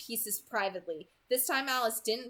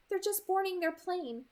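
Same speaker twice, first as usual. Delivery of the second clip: lightly voiced young woman, higher pitch; Alice's dialogue voice; 8 semitones higher than usual. A narrator's reading. Alice updates Bella on the plane, lightly voiced character. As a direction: report the news in a higher character voice.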